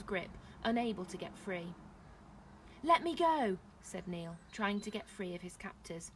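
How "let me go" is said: high, loud demand; struggling protest. A narrator's reading. Neil trying to get free, raised-voice demand. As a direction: demand release.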